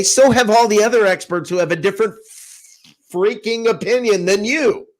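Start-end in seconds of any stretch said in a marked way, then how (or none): none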